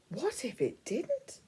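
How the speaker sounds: ordinary delivery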